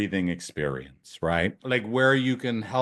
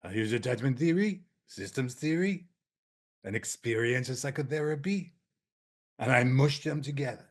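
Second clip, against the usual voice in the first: light, higher French accent, softer; 3 semitones higher, 3 dB quieter than usual. Shown as a demonstration. Therapist answering what do you do, in a French accent. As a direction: say it like a Frenchman explaining his method. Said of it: "I almost put on a French accent again".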